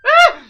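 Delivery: shrill high scream of fright; startled scream. Acted. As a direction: jump out of your skin.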